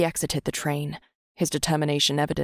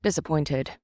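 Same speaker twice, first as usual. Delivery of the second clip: plain reading voice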